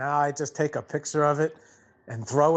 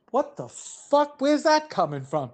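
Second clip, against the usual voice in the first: shocked outburst; 7 semitones higher than usual. Retold or imitated. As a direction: jolt up in pitch, caught off guard. Hears phone talking dirty.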